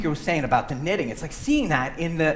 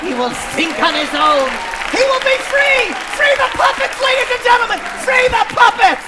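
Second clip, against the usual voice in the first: much higher and louder rallying shout; rallying puppet cry; 8 dB louder than usual. Acted. Puppet calls to the audience, loud high rallying shout. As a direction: proclaim freedom like a revolutionary.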